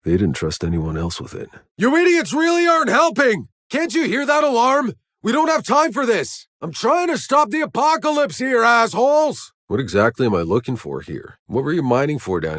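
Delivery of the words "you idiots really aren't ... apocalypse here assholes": furious yelling. Acted. Soldier yelling at enemies while the alarm blares, furious shout. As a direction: scream at them in exasperation.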